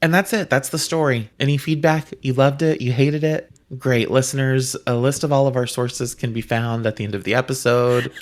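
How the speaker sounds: loud, brisk sign-off; loud mock announcer wrap-up; mock announcer outro.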